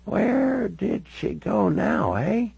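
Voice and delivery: put-on high, very slow elderly Canadian voice; puzzled old Canadian lady, words drawn out; old Canadian woman. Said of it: "She certainly sounded like a lifelong Canadian".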